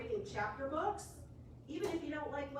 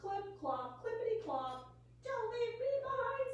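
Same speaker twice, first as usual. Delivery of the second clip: high pleading little duck voice; a small duck calling after the horse, high and pleading; duck's plea; 9 semitones higher than usual. A narrator's reading. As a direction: beg not to be left behind, high.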